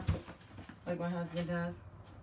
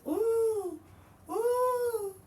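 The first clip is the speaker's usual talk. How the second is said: delighted coos. Real, not acted. Delighted at the item.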